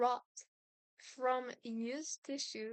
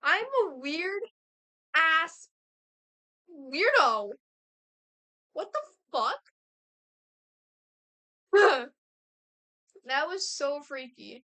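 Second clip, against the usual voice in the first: much louder, laughing at herself; real embarrassed outburst; loud freaked-out laugh; 2 semitones higher, 11 dB louder than usual. Real, not acted. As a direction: shriek at your own weirdness. Laughing at own creepiness.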